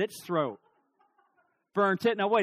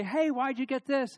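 calling upstairs; 8 semitones higher than usual. Retold or imitated. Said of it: "I'm downstairs in the kitchen".

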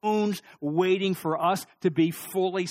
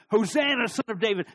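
raised, higher joyful cry; a mock crowd cheer, louder and higher; children shouting; 6 semitones higher, 6 dB louder than usual. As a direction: call it out like excited kids in the street. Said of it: "they keep shouting".

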